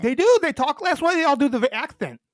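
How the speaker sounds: loud, high, lisped protest; excited lisp, tongue hitting the fangs; lisping through fangs. Real, not acted. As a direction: argue back loudly through fake vampire teeth. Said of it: "all have lisps then".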